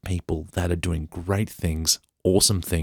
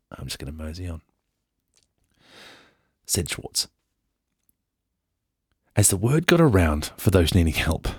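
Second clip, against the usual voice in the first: ordinary talk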